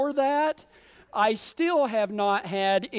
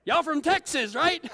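drawn-out, higher-pitched call at spotting a cowboy; excited onlookers; 5 semitones higher than usual. Retold or imitated. Locals greet the cowboy hats in Jerusalem, excited mimicry. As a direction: imitate gawkers calling out eagerly. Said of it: "everybody goes".

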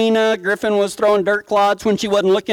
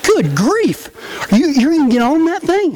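incredulous; 8 semitones higher than usual. Retold or imitated. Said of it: "It's like".

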